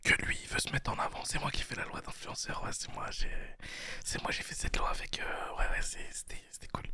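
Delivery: breathy ASMR whisper right into the mic. Pretend. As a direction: whisper it like an ASMR video, half joking. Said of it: "A S M R".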